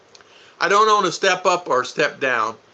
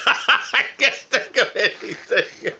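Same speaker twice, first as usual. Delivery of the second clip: slow, higher, laughing through it; 7 semitones higher, slower than usual. Real, not acted. Cracking up at his own blank mind, laughing. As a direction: laugh the words out slowly.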